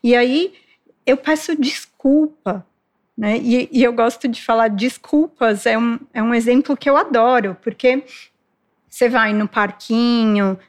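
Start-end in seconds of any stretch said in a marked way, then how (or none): none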